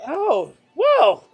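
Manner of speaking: loud, high acted delight; gasping wow at welcome news; hammed-up thrilled surprise; happily amazed. Woman amazed at news of Tom.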